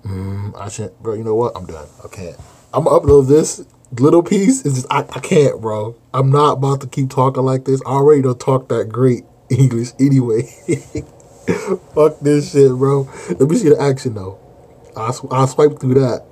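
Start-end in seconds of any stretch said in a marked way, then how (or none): 2.7-10.4 loud exasperated rant. Real, not acted. Gives up reading, exasperated rant. 10.5-11.9 loud high laughter burst. Real, not acted. Bursts out laughing mid-rant. 11.9-16.2 loud exasperated outburst. Real, not acted. Gives up reading, exasperated rant.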